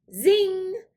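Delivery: high, loud zinging shot; zing like a ricochet; magic zing. A narrator's reading. Magic pistol fires.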